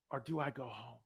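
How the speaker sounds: low, hushed second option; soft, low retold question; weighing a dilemma. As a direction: drop right down for the alternative.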